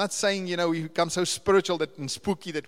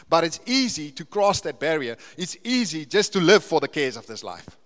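usual speaking voice

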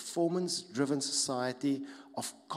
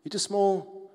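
scoffing, a touch louder; mock the small guy; mocking put-down; 7 semitones higher, 6 dB louder, slower than usual. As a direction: sneer it at him. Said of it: "Literally, word".

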